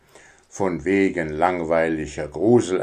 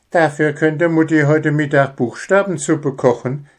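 put-on higher cheeky young voice; grinning; 7 semitones higher than usual. Young hare teases his brother, grinning. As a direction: say it with a grin. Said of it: "grinste Spitznäschen".

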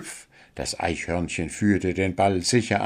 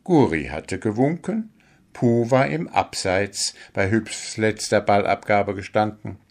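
ordinary delivery, nothing marked

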